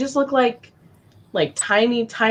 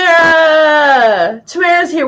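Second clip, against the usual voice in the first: excited greeting; 7 semitones higher, 7 dB louder than usual. Real, not acted. Host greeting a friend who just arrived, excited call. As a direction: hail them with a long, raised shout.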